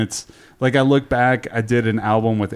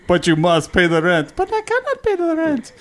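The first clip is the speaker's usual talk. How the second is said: melodrama villain and damsel; 12 semitones higher than usual. Pretend. Hammy melodrama voices, laughing.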